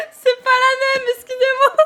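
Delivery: high, laughing apology; giggly apology. Real, not acted. Streamer apologising to chat while still laughing. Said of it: "je suis marseillaise ou quoi".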